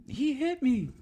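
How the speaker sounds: high falsetto whine; squeaky fake accusation; mocking resident. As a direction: mimic a lying resident.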